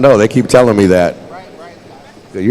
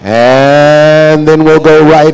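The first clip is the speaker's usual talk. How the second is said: raised, higher announcer call; powwow emcee announcing; 7 semitones higher, 4 dB louder than usual. Acted. Emcee announces the headband fancy special to the crowd, announcer voice. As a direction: stretch it out across the arena.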